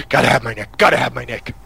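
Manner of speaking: gasped, hammed-up addict's plea; punchy joke craving after a sharp gasp; mock nicotine craving. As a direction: play at desperately needing a fix. Acts out a desperate nicotine craving.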